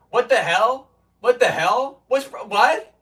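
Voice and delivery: high, loud mock outrage; imitating pissed chatters. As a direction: act out indignant disbelief, questions jumping upward. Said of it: "get pissed at Nightbot".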